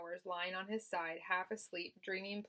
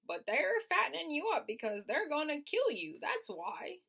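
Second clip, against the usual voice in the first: put-on old sheep voice; 8 semitones higher, 5 dB louder than usual.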